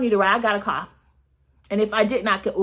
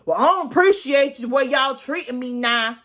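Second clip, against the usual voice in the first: Marlo voice; 4 semitones higher than usual. Retold or imitated. Vlogger in her Marlo voice complaining to the girls. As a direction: play Marlo complaining, a bit above your own voice. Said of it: "let me get in my Marlo voice".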